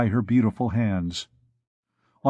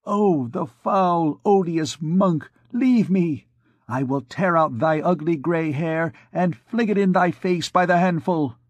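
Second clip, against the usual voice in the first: put-on defiant girl; 6 semitones higher than usual. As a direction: spit contempt at the monk. Girl voiced defying the priest.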